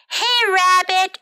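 high, loud storybook call; acted shout. A narrator's reading. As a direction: call out demandingly to a friend. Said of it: "shouted Bird".

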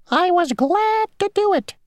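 very high, tiny, fussy insect voice; a small stickler of an insect stating the rule; mosquito voice. Acted. Mosquito ferryman laying down the rule to Grasshopper, tiny high voice.

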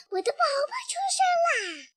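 high, fast, crying out with joy; excited shout. Acted. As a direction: burst out with delight at the new baby. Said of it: "它大叫起来".

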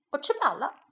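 ordinary delivery

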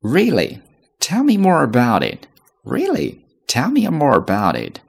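非常感兴趣，语调高; very interested. Shown as a demonstration. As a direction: sound truly curious, voice lifted high. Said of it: "对某一个话题非常感兴趣".

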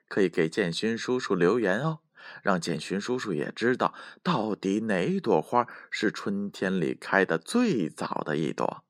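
ordinary delivery

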